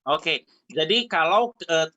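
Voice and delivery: ordinary delivery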